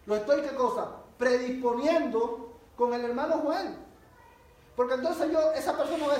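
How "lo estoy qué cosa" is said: ordinary delivery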